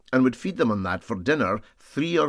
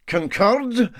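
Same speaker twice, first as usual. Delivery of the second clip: high, scornful one-word sneer; contemptuous snort; 12 semitones higher, 6 dB louder than usual. A narrator's reading. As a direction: scoff at the silly word. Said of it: "with a contemptuous snort".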